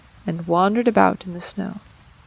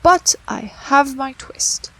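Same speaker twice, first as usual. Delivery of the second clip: put-on old tailor; 5 semitones higher, 4 dB louder, slower than usual. A narrator's reading. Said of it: "said the Tailor of Gloucester".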